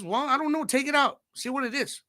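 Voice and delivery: high, innocent mom voice; mom playing along. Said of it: "She says".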